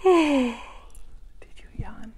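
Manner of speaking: slow, hushed whisper. Acted. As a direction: whisper the question slowly to the children. Whispered question to kids.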